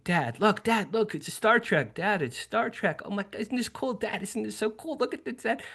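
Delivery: put-on high, breathless-with-wonder kid voice; excited thirteen-year-old. Boy showing his father the UFO, giddy and high-pitched. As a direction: gush at Dad like a kid jumping up and down. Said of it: "I'm jumping up and down. I'm thirteen".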